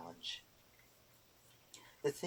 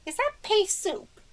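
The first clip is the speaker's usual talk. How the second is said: put-on much higher, slow little old witch voice; Erder voice; 8 dB louder, slower than usual. A narrator's reading. Erder answers the boggart, high prim old witch. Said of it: "she asked".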